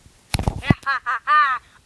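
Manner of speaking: loud evil cackle; a big gloating villain laugh. Acted.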